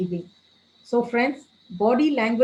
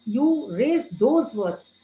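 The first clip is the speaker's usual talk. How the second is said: "You raise those words" very slow, each word pressed; emphasized words; much slower than usual. Shown as a demonstration. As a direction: drag out "raise those words" with firm stress, voice not raised. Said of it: "emphasize on the few things which is wrong".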